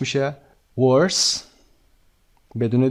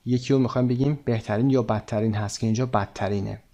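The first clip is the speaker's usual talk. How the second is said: ordinary delivery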